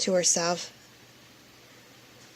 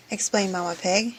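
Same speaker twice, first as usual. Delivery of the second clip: ordinary delivery, nothing marked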